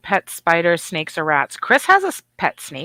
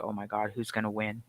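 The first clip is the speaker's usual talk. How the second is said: low, hushed, barely voiced; a quiet hushed aside; hushed wonder; 9 semitones lower, 13 dB quieter than usual. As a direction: murmur it like you're listening in the dark. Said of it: "I'm like".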